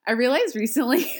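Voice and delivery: usual speaking voice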